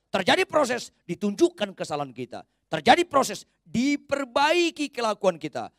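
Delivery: high, loud, hammering each phrase; full-force preaching. Acted. Preacher urging Christians to read the Bible daily, full-force preaching. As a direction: drive it home with fire.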